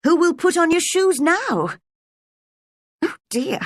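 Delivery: put-on high anxious girl's voice; Alice fretting about her feet; girlish worry. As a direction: fret aloud like a small girl.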